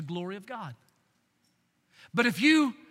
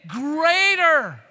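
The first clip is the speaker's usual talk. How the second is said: louder Greater, ringing out; shouting Greater; 7 semitones higher, 10 dB louder than usual. Shown as a demonstration. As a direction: shout it to the back row. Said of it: "Say it again".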